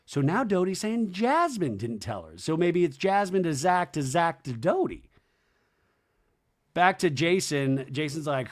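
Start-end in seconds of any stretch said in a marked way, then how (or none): none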